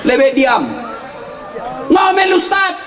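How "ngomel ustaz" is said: complaining husband. Retold or imitated. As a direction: gripe again to the preacher. Congregant complains again.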